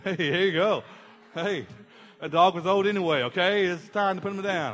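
breezy, delighted brush-off; suddenly cheerful. Acted. Brian cheerfully forgiving after the cash offer, mock glee.

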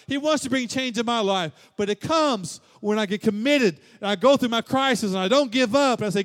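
high, loud, driving preacher's cry; full-force preaching. Real, not acted. Pastor urging the congregation toward change, in a loud, high preaching cry. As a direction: preach it out and lift the room.

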